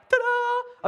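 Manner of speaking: high, showy flourish. Acted. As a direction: present it like a magic trick. Holding up a head.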